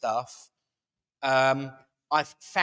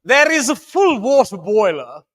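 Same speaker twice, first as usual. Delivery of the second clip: lightly put-on posh English accent, higher and louder; mock posh English accent; 6 semitones higher, 10 dB louder than usual. Host redoing the notice, hammed-up posh English accent. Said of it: "use my proper accent".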